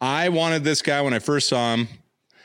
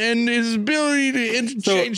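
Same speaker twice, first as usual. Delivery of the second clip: mock British accent; 10 semitones higher than usual. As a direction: proclaim it in a high fake English accent. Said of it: "Like Darth Mark would be out".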